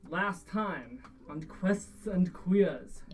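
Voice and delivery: slow, drawn-out show title; recap announcer. Acted. GM opens session like TV.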